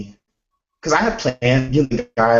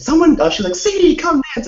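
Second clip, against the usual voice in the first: calling out; 7 dB louder than usual. Retold or imitated. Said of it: "Ashley was like".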